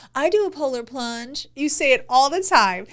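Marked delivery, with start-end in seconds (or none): 0.1-1.5 mocking impression. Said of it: "You're like".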